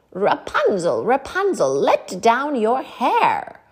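loud, slow calling witch; a witch calling up the tower. A narrator's reading. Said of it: "she would call out".

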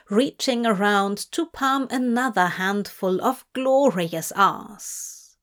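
plain reading voice

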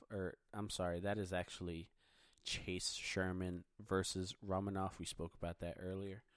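tired, fading voice giving out; worn-out voice, running out of steam. Real, not acted. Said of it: "my voice is starting to give out".

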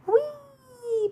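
long, high-pitched whee; drawn-out, soaring cry of delight; gleeful shout. A narrator's reading. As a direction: squeal it out like a kid on a speeding bike. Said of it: "Billy shouted".

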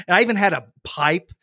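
normal talking voice

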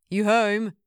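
calling through the flat. Acted. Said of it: "he called".

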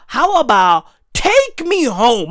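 ordinary talk

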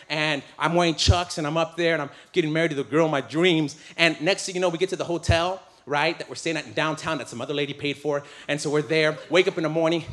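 ordinary delivery

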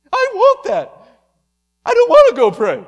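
high, very loud tantrum; screaming flesh. Acted. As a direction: scream it like a demanding child. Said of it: "our flesh ought to be screaming".

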